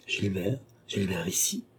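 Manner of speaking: hushed, questioning surprise; surprised, without fear or anger. A narrator's reading. Young woman finding Gilbert in her house, understated surprise. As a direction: say it softly, taken aback. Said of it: "s'écria André avec une surprise".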